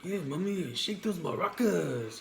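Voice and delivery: slow, drawn-out mock Spanish accent; accented catcaller. Imitating construction worker catcalling her, mock Spanish accent. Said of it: "You know who I feel like I sound like?".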